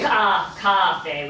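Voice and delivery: "cafe" with a wide open ah in "ca"; open ah sound. Shown as a demonstration. Teacher corrects student's "cafe", modelling an open ah. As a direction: say "cafe" as "kah-FAY". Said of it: "with the ah, ah sound".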